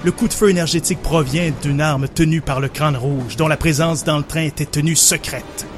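narrating an action scene over music; dramatic story narration. Acted. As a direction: tell it like a movie trailer. Narrates his version of scene.

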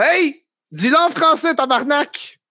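high, outraged barman snapping at a tourist; mock angry Quebecer. Barman scolding a tourist who didn't speak French, mock outraged. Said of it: "il va pas faire".

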